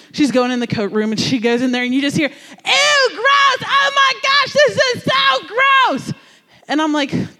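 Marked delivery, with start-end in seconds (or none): 2.3-6.1 put-on very high, shrieked ew-gross of a fourth-grade girl; disgusted girl shrieking. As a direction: squeal it like a grossed-out little girl. Classmate shrieking in the coat room.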